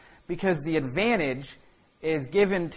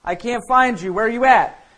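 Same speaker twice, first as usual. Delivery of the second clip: Jesus calling loudly, higher through the garden; 5 semitones higher, 8 dB louder than usual. Acted. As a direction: call out across the garden, searching. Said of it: "he's going".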